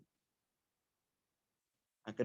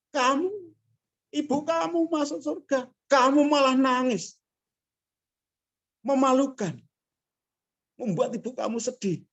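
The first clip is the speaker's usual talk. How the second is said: higher, firm scolding of a student; stern lecturer; 11 semitones higher than usual. Retold or imitated. Lecturer to the crying student, a stern mock scolding. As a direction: scold her into pulling herself together.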